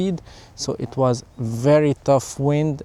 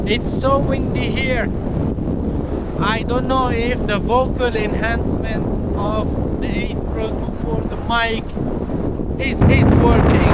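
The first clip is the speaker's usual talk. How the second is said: raised high voice against gusts; shouting over wind; 9 semitones higher than usual. Real, not acted. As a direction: call out over the roaring wind. Outdoor test clip in strong wind.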